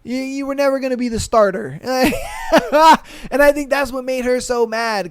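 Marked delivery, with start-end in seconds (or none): 1.8-3.3 loud high burst of laughter; real laugh, high. Real, not acted. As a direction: crack up laughing. Laughs at own joke.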